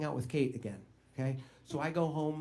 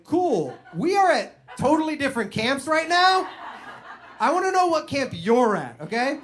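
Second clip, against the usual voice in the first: high, loud incredulous disbelief; loud mock-shocked reply; 8 semitones higher, 6 dB louder than usual. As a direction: react like you can't believe your ears. Friend's shocking confession at camp.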